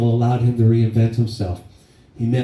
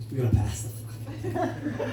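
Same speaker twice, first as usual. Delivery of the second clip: high, softer, laughing through it; laughing complaint; 10 dB quieter than usual. Retold or imitated. Friend at the table begging dad to pass it, laughing. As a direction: squeak it out while laughing.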